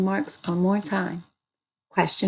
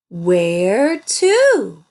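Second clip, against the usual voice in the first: loud, drawn-out question, WHERE and TO both stressed; asked question; 7 dB louder than usual. Shown as a demonstration. Teacher reading cab driver Dan's words, as an asked question. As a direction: call out WHERE TO as a stretched question. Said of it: "Because he asked a question".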